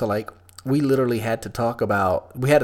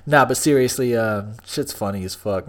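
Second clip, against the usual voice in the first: ordinary talk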